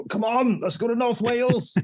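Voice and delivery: high, hammed-up salesman voice; mock pitch. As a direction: sell it like a cheeky undertaker touting trade. Imagined burial businessman pitching North Wales.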